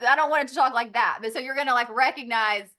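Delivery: ordinary delivery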